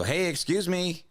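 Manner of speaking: high, drawn-out call for attention. Retold or imitated. Customer flagging a man cutting the line, first call. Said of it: "then I'm yelling".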